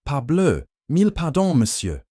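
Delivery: high, loud, hammed-up French exclamation; a pompous diplomat gushing fake apologies; farcical French accent. A narrator's reading. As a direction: overplay the French accent for laughs. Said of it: "his accent now exaggerated to farcical levels".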